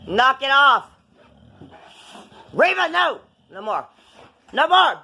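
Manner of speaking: stern, loud telling off; scolding. Real, not acted. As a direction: scold the dog sharply. Scolding dog to stop.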